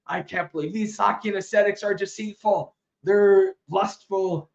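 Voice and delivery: husband denouncing the monks, mock angry; indignant husband. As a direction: spit out the accusation in hammed-up indignation. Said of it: "be totally praising to get that angry".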